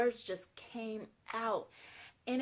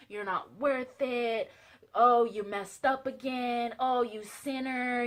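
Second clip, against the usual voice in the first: slow, stretched mock reproach; drawn-out taunting; 3 semitones higher, 4 dB louder, slower than usual. Devil tells you you're unworthy, slow mocking taunt. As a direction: drag out each jab, imitating the accuser.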